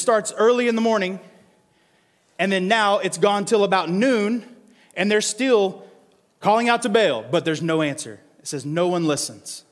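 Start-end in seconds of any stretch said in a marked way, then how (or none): none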